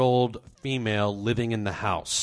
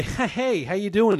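high-pitched, strained mock-friendly hello; put-on greeting; 9 semitones higher, 4 dB louder than usual. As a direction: greet him in an exaggerated high voice. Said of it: "So I walk over to Mike and I say".

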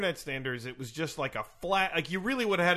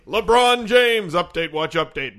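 slow, louder announcer echo; co-host echoes the segment title as a louder announcer; 9 dB louder, slower than usual. Acted. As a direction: boom the title back slowly.

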